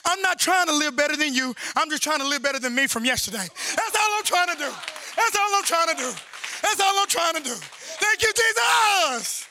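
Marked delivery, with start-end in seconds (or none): 3.5-9.5 insistent repeats climbing to a cry of thanks; exultant preaching. Real, not acted. Preacher thanking Jesus for a new day, exultant preaching. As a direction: repeat it with force, then lift high.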